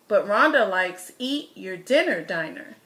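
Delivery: ordinary delivery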